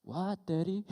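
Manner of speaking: voiced small girl calling back; imitated little daughter. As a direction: answer Dad like a young kid. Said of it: "And she was like".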